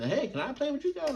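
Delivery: put-on small high kid's voice asking timidly; shy little kid. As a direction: ask like a nervous seven-year-old.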